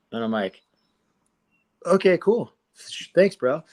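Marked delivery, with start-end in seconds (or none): 1.9-3.6 high, louder and pleased; a hint of excited gratitude; eager thanks. A kid thanking the gym owner, high eager thanks.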